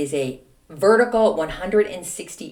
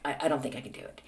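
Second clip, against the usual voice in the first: soft scared doubt; 6 semitones lower, 10 dB quieter, much faster than usual. Retold or imitated. Scared at the top.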